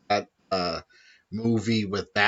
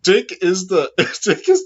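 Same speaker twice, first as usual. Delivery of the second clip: laughing; 11 semitones higher, 11 dB louder than usual. Real, not acted. Gushing while laughing about Dick.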